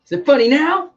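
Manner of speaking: loud, high-pitched mock gloating; mock taunting. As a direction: throw it back at the prankster, hammed-up. Acting out a comeback to prankster.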